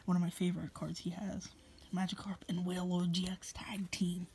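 hushed secret whisper. Real, not acted. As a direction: whisper so he can't overhear. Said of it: "Yes, I'm whispering 'cause he can probably hear".

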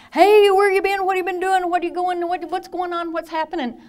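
high, loud rapid-fire questions; patient bouncing with wild energy; manic greeting. Retold or imitated. Manic patient hailing his buddy, high and excited.

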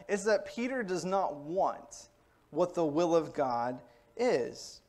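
ordinary talk, nothing marked

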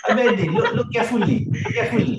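high, loud mock urging; playful call-out. Teasing call-out amid laughter.

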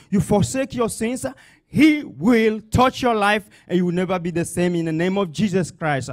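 ordinary talk, nothing marked

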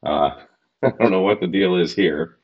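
ordinary talk

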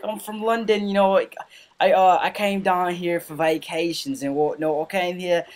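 mock Londoner chatting away; British accent. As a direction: lay on a hammed-up British accent. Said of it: "the best, uh, British accent I could".

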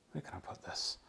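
whispered aside to self; half-voiced mutter to self; whispering. Real, not acted.

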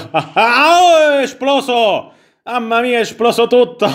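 high-pitched cry of surprise; real amazed outburst. Real, not acted. Player watches everything suddenly blow up, high astonished outburst. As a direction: exclaim in laughing disbelief, voice shooting up. Il gioco esplode all'improvviso.

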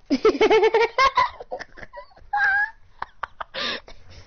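very high squealing laugh; helpless laughter. Real, not acted. Cracking up over the bus story, squealing laughter. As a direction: laugh long until it squeaks.

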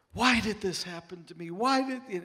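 put-on high, loud child's wail; voicing a hurt four-year-old asking why; daughter's crying why. Dad retelling his crying daughter's why. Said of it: "I just remember her saying".

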